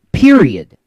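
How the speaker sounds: PERIOD punched loud and high; "Period." as a loud, high-starting full stop; emphatic finality. Real, not acted. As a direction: declare "Period." loudly, falling hard to end it. Closing an argument emphatically.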